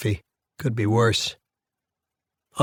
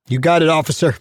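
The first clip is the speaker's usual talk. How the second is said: raised voice, pitched up to carry; calling back; 5 semitones higher, 4 dB louder, faster than usual. A narrator's reading. Inmate answering officer down corridor, calling back higher. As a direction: answer a guard down the hall. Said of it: "I call back".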